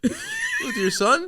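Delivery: very high, slow shocked question; shocked disbelief. Real, not acted. Hearing he shot arrows indoors with his son, very high disbelief. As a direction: squeal it in disbelief. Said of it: "reacts to arrow story".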